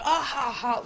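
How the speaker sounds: mock the laughter with a sneer; sarcastic laugh. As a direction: fake mocking ha ha ha, high. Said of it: "like, in a sarcastic laugh".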